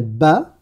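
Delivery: « ba » isolé, b suivi d'un a; syllabe arabe. Shown as a demonstration. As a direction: prononce nettement la syllabe « ba » pour l'élève. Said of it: "je vais prononcer la syllabe correspondante". (« ba » montré comme en leçon)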